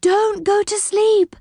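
insistent high wail to stay awake; crying out. A narrator's reading. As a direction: stretch out the plea, very high. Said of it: "cried Silky".

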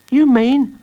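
high, loud astonished question. A narrator's reading. Pete questions Jacob about the letter delivery, astonished. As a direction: ask it in high-pitched disbelief. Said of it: "asked Pete, astonished".

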